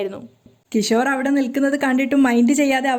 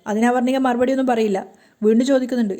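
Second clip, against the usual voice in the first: usual speaking voice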